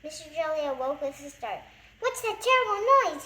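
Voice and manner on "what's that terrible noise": high, loud panicked cry; frightened cry. A narrator's reading. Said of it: "he cried".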